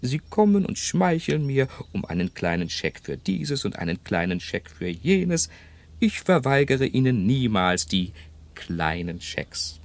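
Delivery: quoting his friend in her high, teasing voice; imitating old Marianne. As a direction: play the shrewd old woman mocking her children. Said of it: "sagte sie".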